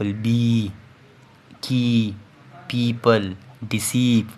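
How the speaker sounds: बी, की, पीपल, deceive with the ee sound held long; long vowel. Shown as a demonstration. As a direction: stretch the ee in बी, की, पीपल and deceive, word by word. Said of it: "long vowel mean जो long होता है".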